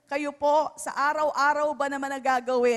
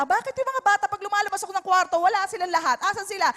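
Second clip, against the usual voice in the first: bewildered parent, mock; 6 semitones higher, 5 dB louder, much faster than usual. Parent wondering where kids went.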